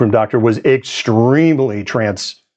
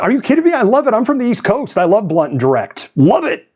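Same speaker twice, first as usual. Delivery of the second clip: much higher, eager retold praise; retold enthusiasm; 9 semitones higher than usual. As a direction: gush that you love bluntness. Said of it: "It's like".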